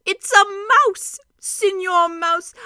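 delighted. Acted. Doctor discovering a mouse soldier, loud delighted exclamation. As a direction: marvel at the tiny knight.

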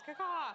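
loud, high eagle cry; high team-spirit bird call; mascot eagle caw. Pretend. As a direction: belt out a playful caw-caw. Imitates eagle after team cheer.

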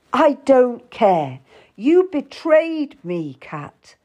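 slow, loud, high reproach; angry accusation. A narrator's reading. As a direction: accuse her, stretching each word angrily. Will accusing Kat of betrayal.

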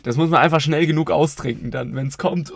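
normal talking voice

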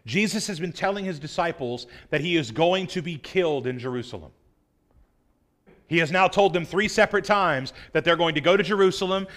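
ordinary talk, nothing marked

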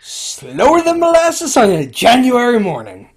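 high, loud, stretched-out hammy saying; slow-as-molasses comic exaggeration; folksy put-on voice. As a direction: draw it out slowly, way over the top. Hammed-up saying about slow word travel.

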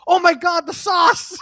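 loud, high mock panic; mock alarm. Wife suddenly remembering her sauce, mock alarm. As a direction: cry it out in sudden pretend horror.

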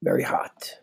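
whispered right after a hot sip; breathy whisper after sipping tea; while sipping a drink. Real, not acted. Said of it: "as I sip on my tea".

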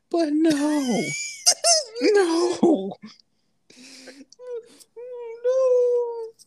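high-pitched helpless laughing; hysterical laugh. Real, not acted. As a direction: laugh until you can barely breathe. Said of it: "Why are you laughing so hard?".

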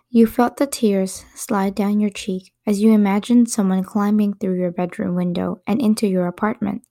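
ordinary delivery, nothing marked

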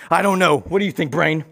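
normal talking voice

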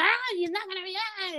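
high, soft imitation of a yell; mock yelling mom. Mom snapping at upset daughter, high mock yell. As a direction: play a mom's yelling at reduced volume. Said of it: "and she start yelling".

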